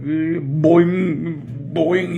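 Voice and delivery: drawn-out cartoon boing noise; imitated boing. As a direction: stretch out a springy boing. Imitating bumping while walking.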